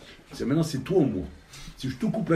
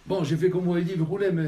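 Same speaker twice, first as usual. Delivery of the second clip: agitated pleading; 8 semitones higher, 7 dB louder than usual. Acted. Anxious boss pleads to rabbi.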